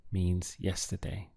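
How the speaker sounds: usual speaking voice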